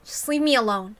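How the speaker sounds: ordinary delivery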